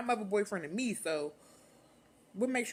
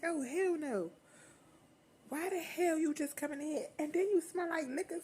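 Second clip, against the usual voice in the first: much higher, outraged mother voice; imitating mom going off; mock scolding mom; 11 semitones higher than usual. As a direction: pitch up into an irate mom. Mother yelling at drunk teen.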